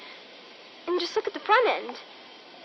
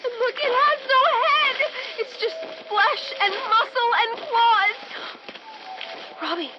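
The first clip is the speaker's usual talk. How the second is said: terrified; 7 semitones higher than usual. Acted. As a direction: panic at the monster coming out of the woods. Sees headless monster.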